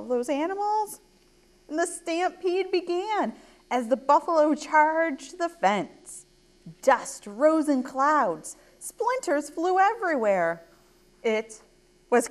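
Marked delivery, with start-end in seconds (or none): 1.7-10.6 dramatic, raised storytelling; excited narration. A narrator's reading. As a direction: build the chaos of a stampede. Narrating the stampede.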